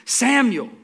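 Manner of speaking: high, loud call; calling by name. Retold or imitated. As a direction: call out the name as God would. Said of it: "calls out to him by name".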